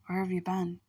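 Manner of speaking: usual speaking voice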